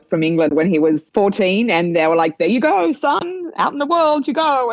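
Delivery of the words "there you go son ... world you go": higher, brisk send-off; lightly voiced elder. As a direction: play the family pushing the boy out. Voicing grandfather's family sending him.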